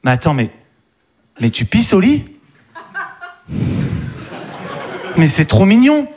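high, gushing and delighted; gushing girl voice. Retold or imitated. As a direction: coo it like a smitten girlfriend. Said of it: "elles vont pas te faire".

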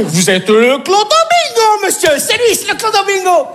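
high, thrilled fan voice; excited fan. Acted. Fan spotting the Clodo Bingo, thrilled and high. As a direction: gush like a starstruck fan.

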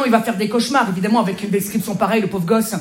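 ordinary delivery

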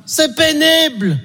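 exasperated whine. Acted.

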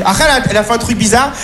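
ordinary talk, nothing marked

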